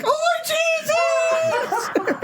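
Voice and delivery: very high, long drawn-out wail; comic rebel yell stretched out in agony; mock battle yell. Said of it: "It was the call of the Irish Brigade".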